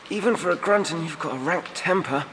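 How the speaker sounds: put-on high young voice muttering from the dirt; young man's voice, muttered. A narrator's reading. Beaten youth mutters at the Grunton, high and sour. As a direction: mutter it up, bruised and defiant. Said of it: "he muttered".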